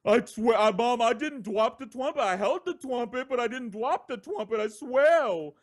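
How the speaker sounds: put-on high, pleading little-boy voice; pleading kid. Boy swearing to his kneeling mom, high pleading kid voice.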